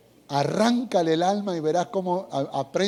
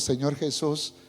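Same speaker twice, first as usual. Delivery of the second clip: oración tranquila y pausada; 2 semitones lower than usual. Acted. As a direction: ora con sinceridad, despacio. Oración modelo con música. (guía a la congregación en oración, con calma)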